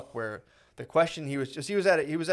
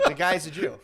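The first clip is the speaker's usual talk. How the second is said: laughing; 4 semitones higher, 4 dB louder than usual. Real, not acted. Jokingly corrects himself, laughing.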